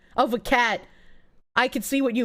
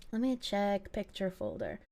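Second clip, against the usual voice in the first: muttered aside; 3 semitones lower, 12 dB quieter than usual. Real, not acted. Muttering while searching files.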